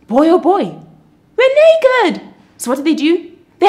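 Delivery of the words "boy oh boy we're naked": high, loud startled cry; hammed-up alarm at the discovery; shocked realization. Adam and Eve realise they're naked, loudly shocked.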